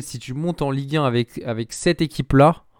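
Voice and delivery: ordinary talk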